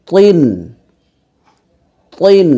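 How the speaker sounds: heavy-t তিন, held out; thick heavy T. Shown as a demonstration. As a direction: make the t of তিন full and heavy. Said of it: "এখানে মোটা করে উচ্চারণ".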